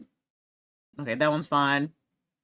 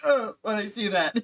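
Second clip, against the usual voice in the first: disgusted; 5 semitones higher, 4 dB louder than usual. Retold or imitated. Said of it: "I'm like".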